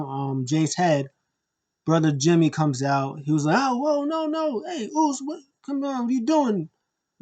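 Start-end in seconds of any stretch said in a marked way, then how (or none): 3.6-6.6 high-pitched imitation of alarm; alarmed pleading. Brother rushing out to intervene, mock alarmed. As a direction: plead with him to stop, voice pitched way up. Acting Jimmy pleading with Roman.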